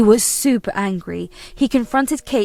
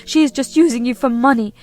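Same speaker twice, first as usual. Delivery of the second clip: higher and louder, accusing the son; angry mother-in-law; 6 semitones higher, 7 dB louder than usual. Acted. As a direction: spit out every insult at the son's wife. Kate demands divorce.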